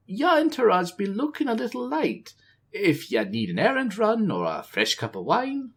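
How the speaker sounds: high, bright-eyed troll lilt; eager troll servant. A narrator's reading. Zakan eagerly offers the queen his help, high troll lilt. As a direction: pitch yourself up, eager to please the queen.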